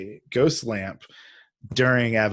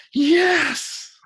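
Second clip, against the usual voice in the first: high, loud burst of joy; retold triumphant yell; 6 dB louder than usual. Actor hearing of the casting in the office, yelling out. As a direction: let out a pent-up celebratory yell. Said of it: "yell out".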